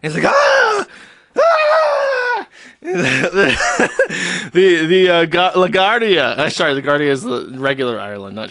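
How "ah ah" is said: high, loud panicked shrieks; hammed-up shrieking in horror; mock terrified screams. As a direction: scream like a kiwi just jumped you. Guy freaks out seeing kiwi.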